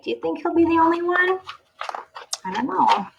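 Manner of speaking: usual speaking voice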